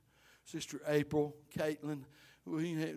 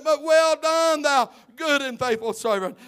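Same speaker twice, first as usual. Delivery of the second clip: shouted blessing; 8 semitones higher, 10 dB louder than usual. Acted. Preacher praising the church workers, loud shouted blessing. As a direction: proclaim it over them.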